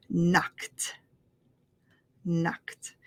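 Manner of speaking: Knocked as one syllable ending in a T; T sound ending. Shown as a demonstration. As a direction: say Knocked softly, finishing on a quick T. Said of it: "it makes the T sound".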